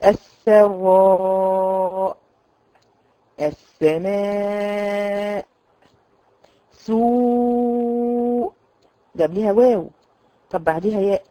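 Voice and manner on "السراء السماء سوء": السماء drawn out very slowly before the final hamza; ترتيل ممدود; long recitation. Shown as a demonstration. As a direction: stretch the vowel in سوء, then close on the hamza. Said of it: "قبلها حرف ألف".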